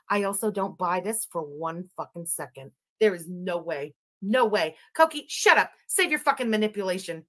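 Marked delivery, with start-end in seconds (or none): none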